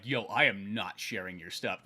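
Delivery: mock a low-voiced brush-off; snooty refusal tone. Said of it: "didn't have to say it in that tone".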